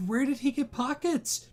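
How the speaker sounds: usual speaking voice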